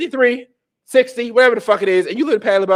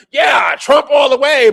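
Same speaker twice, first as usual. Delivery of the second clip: slow, high, loud shout; rallying cheer; 7 semitones higher, 5 dB louder, slower than usual. Acted. Conservative host playing the expected Trump supporter, loud cheering shout. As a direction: shout it out like a die-hard supporter. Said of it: "I'm supposed to be like".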